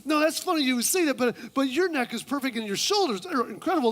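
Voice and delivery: high, hammed-up compliments; mock-girly fussing; overdone sweet friend; gushing woman. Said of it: "Woman number".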